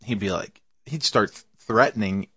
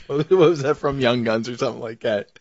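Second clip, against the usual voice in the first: ordinary delivery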